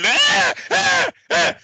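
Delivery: high squealing yelps, hammed up; comic high-pitched whimpers; mock yelps of pain. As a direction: yelp like a kid being spanked. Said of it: "He'd be like that".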